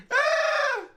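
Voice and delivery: very high shrieking laugh. Real, not acted. Streamer shrieks with laughter at the clip.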